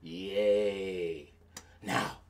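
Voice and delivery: ordinary delivery, nothing marked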